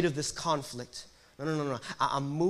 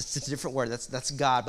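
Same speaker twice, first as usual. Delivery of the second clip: normal talking voice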